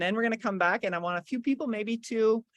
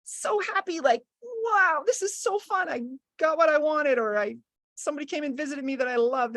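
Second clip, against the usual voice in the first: much higher, bubbly happiness; demo happy; 6 semitones higher than usual. Shown as a demonstration. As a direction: show pure joy. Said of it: "what it feels to be so happy".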